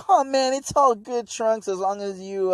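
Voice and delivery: put-on high, eager kids' voice. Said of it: "these kids are like".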